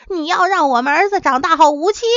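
furious. A narrator's reading. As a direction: she nearly leaps up in anger. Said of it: "妻子气得差点跳起来".

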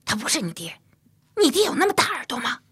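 rising, fast sneer at the man's ears; mock him while scolding the boy; mocking jab. A narrator's reading. Mother mocks the stranger's big ears, fast and shrill. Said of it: "又说".

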